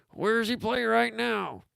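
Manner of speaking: a hammed-up grumpy old coach asking; bad Heathcote impression. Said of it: "It's a bad Heathcote impression".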